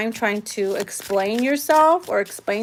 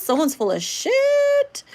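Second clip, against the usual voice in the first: much higher and slow, drawn out; sassy high call-out; 13 semitones higher, slower than usual. Real, not acted. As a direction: sing it out high like a knowing call-out. Card reveals a liar.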